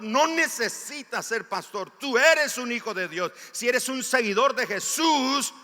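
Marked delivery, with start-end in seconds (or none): none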